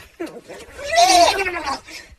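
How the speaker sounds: high, loud cracking-up laugh. Real, not acted. After imitating Amber, a high loud laugh. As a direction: burst out laughing.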